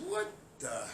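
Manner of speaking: low, soft, disgusted question; quiet disgust. Real, not acted. Scolding protesters, low and soft.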